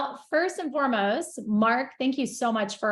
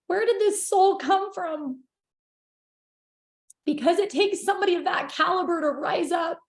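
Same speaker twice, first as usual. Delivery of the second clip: higher, tearful voice with halting pauses; emotional, crying; 4 semitones higher than usual. Real, not acted. Guest honouring Randy and fellow entrepreneurs, tearfully emotional. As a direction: push through real tears to praise them. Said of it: "getting emotional here".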